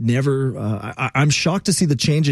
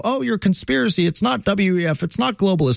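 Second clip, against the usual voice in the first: higher-pitched mimicry of dismissive critics; mocking naysayers; 7 semitones higher than usual. Host mimics people who brush off his warnings, mocking. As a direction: scoff like the doubters, pitched up.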